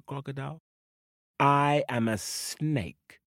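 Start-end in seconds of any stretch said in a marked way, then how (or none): none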